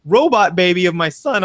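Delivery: ordinary talk, nothing marked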